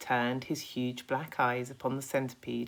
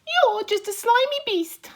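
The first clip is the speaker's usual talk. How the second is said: much higher, louder cocky insect voice; a boastful little bug, pitched way up; Centipede voice; 11 dB louder than usual. Acted. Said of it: "answered the Centipede".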